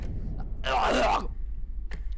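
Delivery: harsh sputtering cough; choking cough. Real, not acted. Player choking mid-speech, real coughing fit. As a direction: cough and choke. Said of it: "Sorry, I'm choking".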